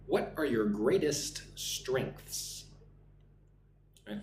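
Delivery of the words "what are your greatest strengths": slow interviewer question. Shown as a demonstration. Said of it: "the interviewer asks me".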